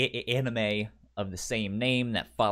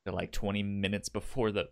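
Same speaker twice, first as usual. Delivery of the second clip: ordinary talk, nothing marked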